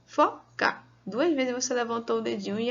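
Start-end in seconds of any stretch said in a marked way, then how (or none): 0.0-0.8 "fo", pause, "ca", pitched high; syllable by syllable. Shown as a demonstration. Teacher counting syllables as an example, syllable by syllable. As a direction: count out "foca" in two beats. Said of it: "vamos contar as sílabas".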